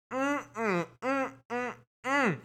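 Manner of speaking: high-pitched mock yummy "mm-mm"; yummy humming. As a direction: hum as if savouring something tasty. Mock relish at pus.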